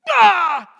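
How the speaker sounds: high, very loud yell of fright; panicked scream. Acted. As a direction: scream as if waking to an army. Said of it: "He's freaking out".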